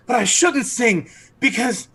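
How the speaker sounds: high, swooning, pleading voice; charmed archer begging forgiveness, high and swooning; lovestruck archer. Acted. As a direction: gush the apology, smitten and breathless. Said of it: "He's like".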